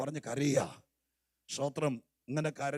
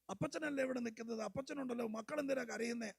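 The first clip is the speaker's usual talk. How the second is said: higher, softer, coaxing a crying grandchild; cooing grandpa tone; 8 semitones higher, 5 dB quieter than usual. Shown as a demonstration. Pastor showing the wrong tone, soft grandfatherly comfort. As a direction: coo it like a grandfather soothing a child. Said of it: "ആ tone അല്ല".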